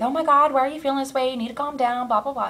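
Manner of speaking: high, fussy mock husband rant; lightly hammed-up nagging; mock bombarding husband. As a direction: pretend pestering questions. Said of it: "bombard me with".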